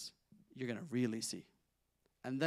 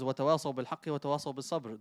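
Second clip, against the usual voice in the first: Quranic recitation; much faster than usual. Acted. Quoting a Quran verse.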